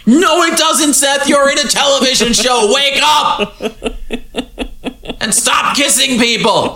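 high-pitched mock scolding rant; hammed-up, high exasperated shout; exasperated yelling. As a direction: yell at a fictional character to snap out of it. Host scolds the character, "I'm like.

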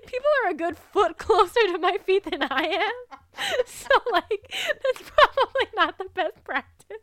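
very high, loud laughing through the words; laughing at her own badly painted toes. Real, not acted. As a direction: laugh so hard the voice climbs and cracks.

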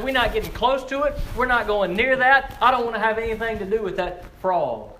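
acted alarm, without a full scream; mock cry of refusal. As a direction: protest in a high, loud preaching voice. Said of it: "we screamed and cried".

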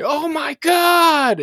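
high, loud, drawn-out cry of alarm; mock shout. Husband panicking in bed, loud high shout. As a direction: shriek it out in a panic.

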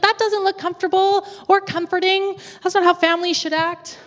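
high-pitched, incredulous objection; mock dismay. Viewer reacting to stiff royal family, mock dismay.

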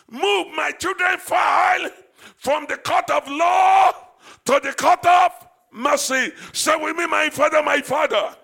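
loud, driving prayer leader; preaching at full force. Acted. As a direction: urge the church to cry out to God. Leads congregation in loud prayer.

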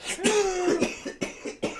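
heavy wet cough; hacking cough. Real, not acted. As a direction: cough hard and long. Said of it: "you sound like you're dying over there".

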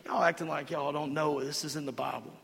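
ordinary delivery

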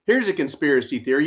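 ordinary talk, nothing marked

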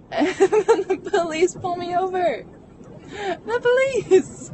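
high, loud, half laughing and half crying; breaking up in a laugh-sob at the absurdity; laugh-crying disbelief. Real, not acted. Telling a friend about the police stop, laugh-crying disbelief.

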